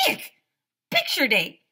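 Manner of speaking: put-on girl squealing with glee; excited yell. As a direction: shriek it out happily. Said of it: "yelled Andrea".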